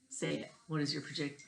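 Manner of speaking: usual speaking voice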